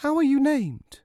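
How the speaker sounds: high, loud maternal question; mother's voice. A narrator's reading. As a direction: ask eagerly as the mother. Mother asks child's name.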